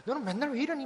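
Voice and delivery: high-pitched imitation of a scolding mother; mock angry nagging. Pastor imitates a mother hitting and raging at her child, mock angry. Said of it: "어머니가 막 화내면서 막 때려가면서".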